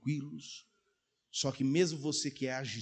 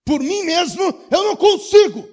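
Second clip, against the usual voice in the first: desperate cry; 17 dB louder than usual. Acted.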